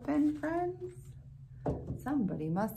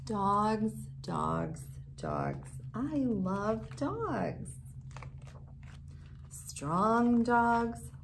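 slow, sing-song picture-book reading; gentle rhyming read-aloud; reading to children; slower than usual. A narrator's reading. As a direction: savour each rhyme slowly for little ones. Reads rhyming dog book aloud.

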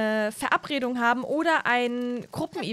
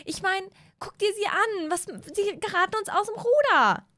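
higher, indignant mom to her husband; higher indignant mother complaining to husband; upset mom; 9 semitones higher than usual. Acted. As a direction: vent to your partner about the kids. Mom Mara worried.

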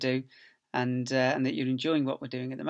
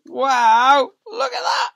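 much higher, louder gasp of wonder; amazed wow; 12 semitones higher, 13 dB louder, much slower than usual. Acted. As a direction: act wowed for the children. Amazed at the jumbo picture.

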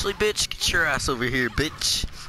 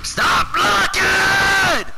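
high, loud, drawn-out scream; screaming rage; 7 dB louder, much slower than usual. Real, not acted. Gamer to a blocking opponent, screaming rage.

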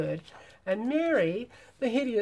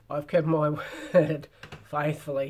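hammed-up manly voice, louder than usual; 7 dB louder than usual. A narrator's reading. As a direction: put on a big manly voice for the knight. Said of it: "in a manly voice".

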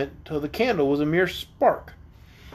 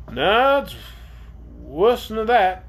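raised, drawn-out sailor's reply; emphatic bad news; 4 semitones higher, slower than usual. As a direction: stretch out the grim correction, pitched up. First take, redone as sad reply.

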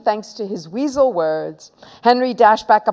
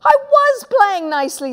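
high indignant whine; protesting innocence; 14 semitones higher, 3 dB louder than usual. Acted. Boy insisting to mum he was nice, high protest.

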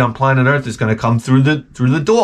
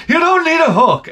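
much higher, dismissive wife voice; shrill mock wife; 3 dB louder than usual. Husband mimics wife waving off his hook request, shrill. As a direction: brush him off in a high squeal.

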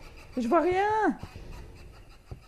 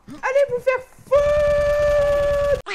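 drawn-out angry yell; 7 semitones higher, 6 dB louder, much slower than usual. Real, not acted. Slow defiant yell at enemy.

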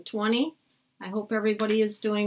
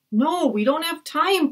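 high urgent cry; cried; 7 semitones higher than usual. Acted. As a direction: stop him sharply. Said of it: "cried Annie".

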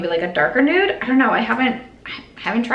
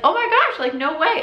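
shocked gasp, pitched way up; surprised; 9 semitones higher than usual. Retold or imitated. Walking into the surprise brunch, shocked high squeal. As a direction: squeal like you just spotted a friend. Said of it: "I was like".

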